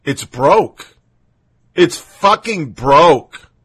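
slow, drawn-out, building to loud disgust; exasperated disbelief. Retold or imitated. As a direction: groan it out like you can't believe what you read. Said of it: "just going".

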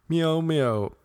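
playful cat call; cat meow. Pretend. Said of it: "cat impression".

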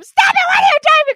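high, loud mock yell at him; retold yelling. Yelling at her partner to stop, hammed-up panicked yell. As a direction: holler it like you're panicking. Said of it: "I start yelling at him".

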